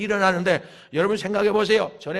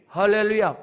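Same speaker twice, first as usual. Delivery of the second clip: normal talking voice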